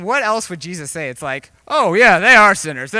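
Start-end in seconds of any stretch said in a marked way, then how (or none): 1.7-2.9 loud, raised, put-on gushing agreement; hammed-up going along with gossip; mock agreement. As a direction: agree sarcastically, overdoing it. Mockingly voicing an agreeing Jesus.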